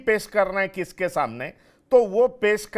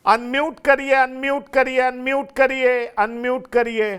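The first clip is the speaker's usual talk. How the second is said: loud, higher repeated call; 5 semitones higher, 7 dB louder than usual. Real, not acted. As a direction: call out to a guest who can't hear. Anchor calling to muted guest.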